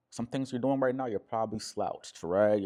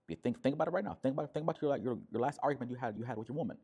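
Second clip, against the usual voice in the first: normal talking voice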